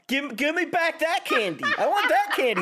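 put-on high, laughing whine like a child after candy; whiny kid. Podcaster mocking herself craving candy, whiny kid voice. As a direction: beg for the candy like a spoilt kid.

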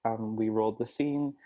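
ordinary talk, nothing marked

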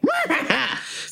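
short high horse neigh; reluctant whinny. Retold or imitated. Giving in to being ridden, reluctant pony whinny. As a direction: give a grudging little whinny.

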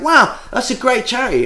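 high-pitched put-on admiration; over-the-top enthusiasm. Praising an acquaintance's charity, feigned enthusiasm. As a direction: fake being impressed, high and gushing. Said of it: "over the top enthusiastic about it".

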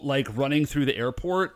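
ordinary delivery

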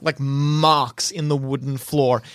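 normal talking voice

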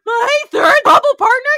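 very high, fast screech; scandalized, over-the-top yell; mock freak-out. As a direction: shriek it in hammed-up disbelief. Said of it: "He's, like, freaking out".

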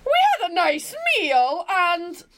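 put-on drawn-out, emphatic Glasgow accent; granddad's Glasgow accent. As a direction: read slowly as a Glaswegian granddad. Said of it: "do it in his accent".

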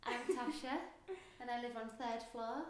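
ordinary talk

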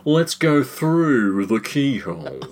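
very slow, drawn-out presenter drawl; glamorous old Hollywood star; Lloyd Grossman impression. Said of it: "That was very good".

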